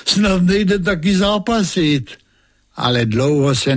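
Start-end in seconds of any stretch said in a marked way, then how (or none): 0.1-2.1 much higher sneering taunt; haughty king's derisive jibe; mocking king. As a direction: scoff at the boy's nerve. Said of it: "smál se král".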